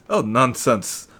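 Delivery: loud, slow dismissive scoff. Acted. The doctor waves off Helga's worry, loud scoff. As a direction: brush it off loudly.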